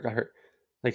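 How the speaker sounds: usual speaking voice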